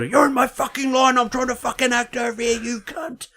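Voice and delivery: high-pitched mock tirade. Actor rages at someone in his line, mock yell. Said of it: "And then he yelled".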